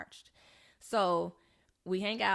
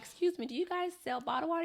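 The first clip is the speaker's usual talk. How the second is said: high, courteous customer voice; polite customer request; 10 semitones higher than usual. Retold or imitated. Asking at the bar for bottled water, high polite voice. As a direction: ask sweetly, pitched well up.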